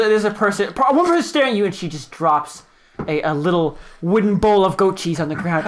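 ordinary talk

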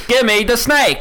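hammed-up Cockney plumber accent. Plumber calling for the snake, hammed-up Cockney. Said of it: "why are you turning Cockney now".

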